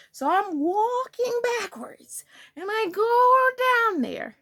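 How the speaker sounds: slow, high, stretched-out narration; sneaky creeping. Acted. Storyteller sneaking up behind classmate, drawn-out sing-song. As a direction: stretch the words to mimic creeping backwards.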